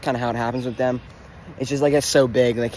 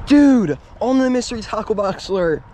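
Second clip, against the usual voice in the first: excited; 7 semitones higher, 3 dB louder than usual. Real, not acted. Reacting to the catch.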